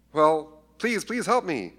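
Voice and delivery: a mother begging Jesus, pleading high; desperate plea. Acted. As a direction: beg him with everything. Woman begging again.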